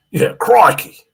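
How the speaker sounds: hint of an Australian exclamation; Steve Irwin crikey. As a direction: toss in a mock Aussie crikey. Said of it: "the Steve Irwin voice".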